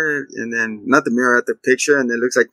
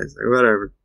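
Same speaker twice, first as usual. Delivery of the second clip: ordinary talk, nothing marked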